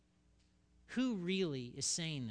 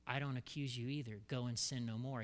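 softer, tender forgiving words; gentle Jesus; 4 semitones lower, 5 dB quieter than usual. Acted. Jesus gently releasing the accused woman, soft and tender. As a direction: lay the words down softly, with mercy.

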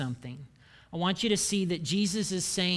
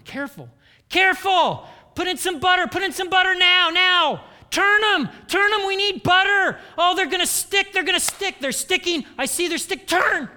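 much higher, much louder frantic yelling about the eggs; 11 semitones higher, 8 dB louder than usual. Retold or imitated. Husband barges into kitchen, yells frantically at wife frying eggs. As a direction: yell in a panic over the pan. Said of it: "immediately started yelling".

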